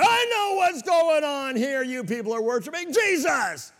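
high, loud outburst in church; blurting demon. Retold or imitated. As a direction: blurt it like a possessed heckler. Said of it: "Someone just blurts out".

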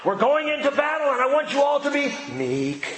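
high, far-off commanding call to the troops; mock admiral calling out. Admiral addressing his crew before battle, mock rallying cry. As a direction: play a fleet admiral rallying sailors. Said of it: "calling out".

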